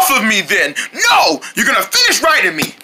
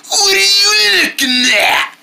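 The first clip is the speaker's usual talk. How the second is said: slow tearful shout; 7 semitones higher, slower than usual. Acted. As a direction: drag it out, half crying, half yelling. Armadillo challenges stranger.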